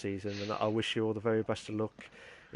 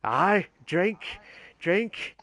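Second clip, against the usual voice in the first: loud, higher hammed-up Father Jack; 8 semitones higher, 7 dB louder, much slower than usual. Host mimics Father Jack, guest's drinking voice in a hammed-up way. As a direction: bellow drink like a boozy old priest. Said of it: "Sound like Father Jack from, uh, from, uh, Father Ted".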